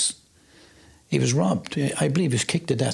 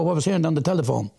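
normal talking voice

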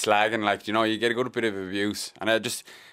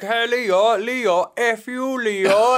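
high, loud mocking yell of a Cork fan; mock Cork heckler; 13 semitones higher, 8 dB louder, much slower than usual. Cork fan heckling the mascot, high mock yell. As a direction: shout it up high like a heckler winding him up. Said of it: "he's like".

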